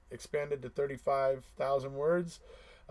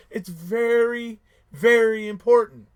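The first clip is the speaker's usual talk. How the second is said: very, very stretched out slow, higher and much louder; emphatic insistence; 8 semitones higher, 12 dB louder, slower than usual. Real, not acted. Streamer hammering the point home to fans, emphatic. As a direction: lean hard on each very. Stressing importance to his viewers.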